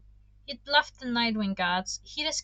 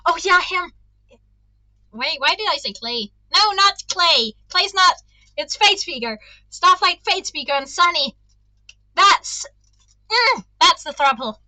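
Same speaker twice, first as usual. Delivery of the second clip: louder, higher, gushing; excited fan rant; 6 semitones higher, 11 dB louder than usual. Real, not acted. Reader gushing about the throuple, loud and excited. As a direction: gush about the ship.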